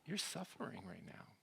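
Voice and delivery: soft, gentle words to someone suffering; empathic listener. Shown as a demonstration. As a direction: speak quietly as if sitting beside someone in pain. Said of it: "but just really understand".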